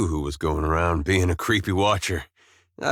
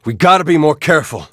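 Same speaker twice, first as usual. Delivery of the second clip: loud, quick, raised-pitch warning; urgent shouted warning; 5 semitones higher, 9 dB louder, faster than usual. Acted. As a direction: call out to the party in a fight.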